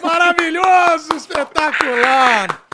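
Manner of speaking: gritado, alto e lento; elogio empolgado. Real, not acted. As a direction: grite de entusiasmo. Elogio efusivo. (elogio gritado e arrastado)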